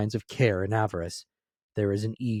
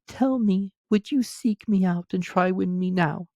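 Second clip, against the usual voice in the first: much higher woman's voice; the girl releases young Scrooge, higher voice; grieving young woman; 10 semitones higher than usual. A narrator's reading. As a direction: voice the girl sorrowfully, pitched high. Girl's voice.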